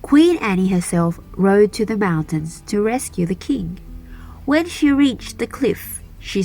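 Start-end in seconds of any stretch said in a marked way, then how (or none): none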